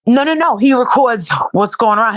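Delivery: ordinary talk, nothing marked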